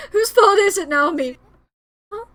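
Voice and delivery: sobbing, shouted accusation; crying outburst. Acted. Grieving boy demands of Naomi whose fault it is, crying shout. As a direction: hurl the question at her through tears.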